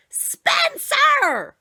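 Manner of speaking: high loud scream of the name. Acted. Mother discovering the mess, loud high scream. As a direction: shriek his name in outrage. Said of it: "she screamed".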